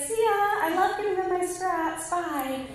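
high, loud cheery goodbye; breezy passerby. Retold or imitated. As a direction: call out a breezy farewell in passing. Woman calls out driving off.

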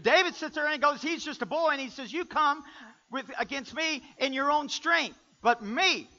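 loud, high preacher's retelling; full-force preaching. Acted. Preacher acting out young David facing Goliath, full-force preaching. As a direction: punch it out to the congregation.

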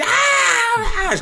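high, drawn-out imitated shriek; mock scream. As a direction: hysterical woman's wordless screech. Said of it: "screaming at me".

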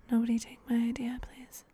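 fast, soft whispered plea; pleading whisper. Acted. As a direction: whisper quickly, half-joking plea. Pleading listeners not to steal.

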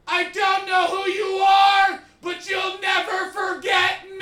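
very high, slow, sing-song bellow; imitating Tim yelling. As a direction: yell it out like a proud declaration. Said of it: "Tim yelled".

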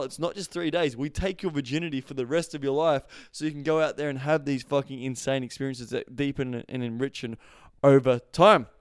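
ordinary talk

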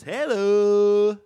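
high, loud put-on greeting; mock women's voice. Shown as a demonstration. Host demonstrates how the women talk, high mock voice. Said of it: "The women talk like this".

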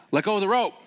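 high loud command; instructor's order. Retold or imitated. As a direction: bark it over the rapids. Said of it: "and the guy says".

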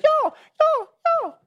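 high childish mocking y'all y'all; squeaky kid voice teasing the teacher's y'all. Kids mocking their teacher's Southern y'all in squeaky childish voices. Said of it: "They'd be like".

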